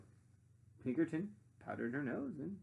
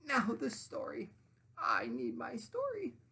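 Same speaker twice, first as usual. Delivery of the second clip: high, slow insistent sandwich voice; high whiny demand; 10 semitones higher, slower than usual. Acted. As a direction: drag it out like a spoiled child.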